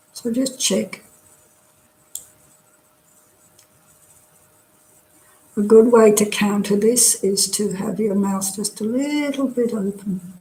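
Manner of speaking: calm, unhurried meditation guide; guided meditation. Real, not acted. Teacher settling an online class into body scan, guided meditation. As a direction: talk them gently through relaxing, with long pauses.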